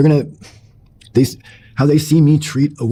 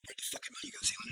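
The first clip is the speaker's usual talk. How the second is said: whispered mock demand; 24 dB quieter, faster than usual. Wife pressing about missing money, in a whisper. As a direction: whisper her demand, barely voiced. Wife's repeated question, whispered retelling.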